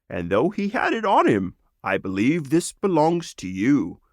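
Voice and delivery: a friendly frog returning a treasure; Krogrog the frog. Acted. Frog returns the sword to the lizard, lightly voiced.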